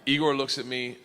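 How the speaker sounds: ordinary delivery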